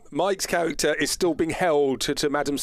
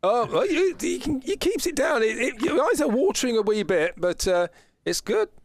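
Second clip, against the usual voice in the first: very high, cheery trader; high merchant voice; 11 semitones higher than usual. Acted. Merchant reassuring dwarf about the jerky, high cheery voice. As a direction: squeak it out like a jolly peddler.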